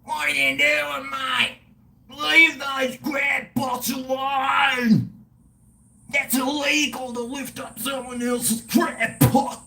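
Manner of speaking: high, shouted impression of an irate local yelling from his boat; a mock-outraged fisherman bellowing at thieves; angry boat guy. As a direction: play the furious bloke on the boat, yelling across the water. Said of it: "some guy goes past on his boat and goes".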